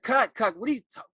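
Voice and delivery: loud, high, impatient teacher scolding; acting teacher scolding the breathy student, loud and impatient; bold acting teacher. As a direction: push the student to just talk plainly. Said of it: "She said".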